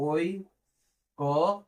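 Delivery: ঐকমত্য dragged out very slowly; slow syllable-by-syllable dictation. Shown as a demonstration. Teacher slowly dictating ঐকমত্য syllable by syllable. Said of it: "আমরা এইভাবে লিখবো".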